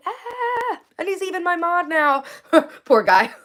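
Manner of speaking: laughing. Real, not acted.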